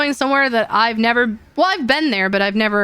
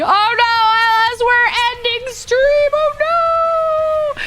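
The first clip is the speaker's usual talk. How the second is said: high, mock-alarmed cry; dismayed surprise; 11 semitones higher, slower than usual. Real, not acted. Streamer telling late chatter Alice stream is ending, mock alarm. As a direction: exaggerate dismay at bad timing.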